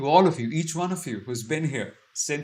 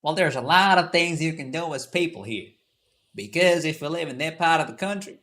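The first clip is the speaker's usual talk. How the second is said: put-on American South accent, somewhat raised pitch; 3 semitones higher, 4 dB louder than usual. Shown as a demonstration.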